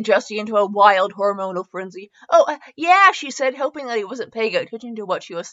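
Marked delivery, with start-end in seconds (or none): 2.3-3.1 high, loud and flustered; flustered reply. Acted. Jessie flustered by the hot man, high and loud. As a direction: blurt it out caught off guard.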